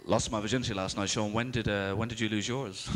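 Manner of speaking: deep, cocky boast; deep, swaggering teenage brag; cocky mate. Retold or imitated. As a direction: boast it low like a cocky lad. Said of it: "Like, really cocky".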